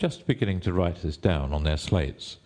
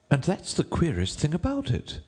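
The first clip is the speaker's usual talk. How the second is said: high, deferential courtier; 10 semitones higher than usual. A narrator's reading. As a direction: address the king in a high rabbit voice.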